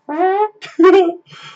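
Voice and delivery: loud high burst of laughter; big laugh. Real, not acted. Streamer bursting out laughing at a chat message, loud high laugh.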